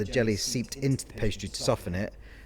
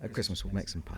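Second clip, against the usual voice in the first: whispering; 3 semitones lower, 8 dB quieter than usual. Real, not acted. Whispered aside.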